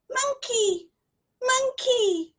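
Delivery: put-on high, eager little child voice; the child holding his arms out, asking high and eager. A narrator's reading. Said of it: "and he said".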